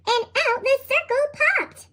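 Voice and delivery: usual speaking voice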